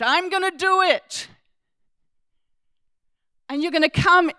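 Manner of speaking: high, loud emphatic promise; voicing God. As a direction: voice God's promise with fervour. Preacher voices God's promise.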